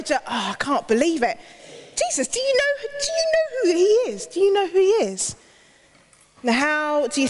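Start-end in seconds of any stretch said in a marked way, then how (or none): 2.0-5.3 indignant protest. Acted. Crowd protests to Jesus.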